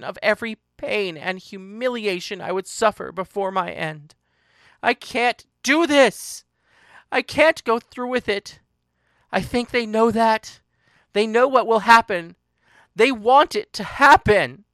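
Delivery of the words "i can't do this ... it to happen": understated wail, high and loud; distraught wailing. A narrator's reading. Narrator breaking down to Paris, loud high distress. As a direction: cry out in despair, voice raised. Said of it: "I cried, I screamed, I wailed".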